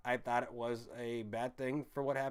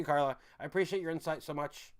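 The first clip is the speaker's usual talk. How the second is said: ordinary talk, nothing marked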